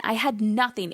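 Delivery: usual speaking voice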